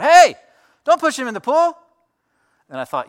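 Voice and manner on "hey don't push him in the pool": loud, high-pitched scolding shout; angry dad yell. Retold or imitated. Dad yelling at kids who pushed his son, angry shout. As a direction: holler it across the pool like a mad dad. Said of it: "dad mad thing going".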